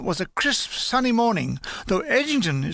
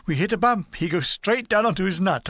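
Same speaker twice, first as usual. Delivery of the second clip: ordinary delivery, nothing marked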